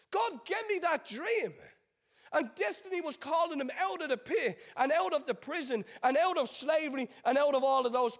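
high, driving sermon delivery; impassioned preaching. Acted. Preacher urging the congregation through Joseph's journey, impassioned. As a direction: preach it with rising and falling emphatic waves.

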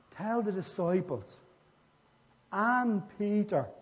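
slow right down and punch "and Peter"; stress on Peter. Acted. Angel singling out Peter to the woman, stress on "and Peter". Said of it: "picking Peter out".

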